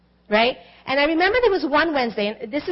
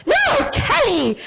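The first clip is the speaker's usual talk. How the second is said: friend's correction; 11 semitones higher, slower than usual. Retold or imitated. Friend correcting her, retold.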